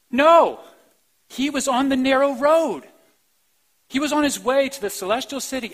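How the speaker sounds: loud, high, ringing denial and affirmation; rising preacher's declaration; emphatic no. Real, not acted. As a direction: proclaim it to the back row. Preacher rebuts doubt.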